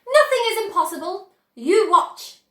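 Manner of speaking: high, loud and quick; rally everyone with it; excited. A narrator's reading. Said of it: "cried Mister Wonka".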